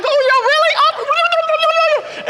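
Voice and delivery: high, slow incredulous drawl; mock disbelief. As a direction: stretch it out, amazed they are still talking. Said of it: "I go".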